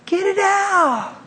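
high, slow urging; tipsy urging. Retold or imitated. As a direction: draw it out up high. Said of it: "She's like".

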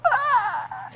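high-pitched mock bawling wail; high put-on crying sound; imitated wail. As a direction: imitate someone breaking down bawling. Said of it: "she just lost it".

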